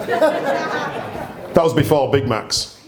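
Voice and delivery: That drawn out slowly through laughter; laughing, stretching That was before Big Macs; slow laughing punchline. Real, not acted. Telling the army nickname story to guests, slow and laughing.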